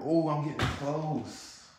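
high, slow, stretched-out hope; excited suspense. Real, not acted. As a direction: cheer yourself on as it nearly goes. Shooting without looking.

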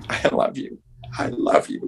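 soft unvoiced whisper. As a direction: breathe the words tenderly. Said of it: "heard her mother going".

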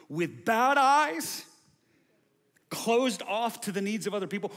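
full-throated sermon climax, pounding each line; preaching at full force. Real, not acted. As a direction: drive the list home like a revival preacher.

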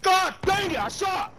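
frustrated shout. Real, not acted. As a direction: yell it out in frustration. Frustrated outburst during gunfight.

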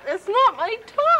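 ordinary delivery

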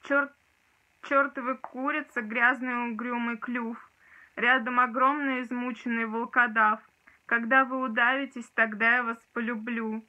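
intense poetry reading; dramatic poetry declamation. Acted. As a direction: declaim it with rising force. Recites second poem.

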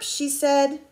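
ordinary delivery

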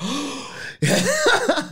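shocked gasp. Retold or imitated. Shocked at what coach said.

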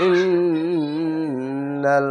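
ইন্নাল, very slow, humming on the doubled n before releasing "nal"; ghunna demonstration. Shown as a demonstration. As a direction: hold the nn of ইন্নাল in a long nasal hum. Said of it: "এভাবে গুণনা হবে".

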